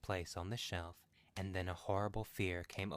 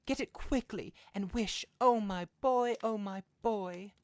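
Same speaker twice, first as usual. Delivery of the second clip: desperate grief; 14 semitones higher, 10 dB louder than usual. Acted. Mother begging for her dead son.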